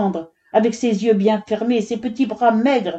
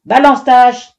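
loud, higher-pitched shouted order; commanding; 5 semitones higher, 9 dB louder than usual. A narrator's reading. Foreman ordering the woodcutter to strike, commanding. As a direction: order it forcefully, no argument. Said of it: "ordonna le chef".

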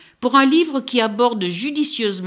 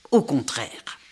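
unvoiced hushed aside; whisper; 6 semitones lower than usual. Real, not acted. Critic hints at the twist to listeners, in a whisper. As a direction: drop to a whisper, confiding. Dropped aside after disappointment.

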